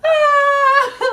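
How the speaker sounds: a high squeal of laughter; high laughing shriek. Real, not acted. Bursts out laughing.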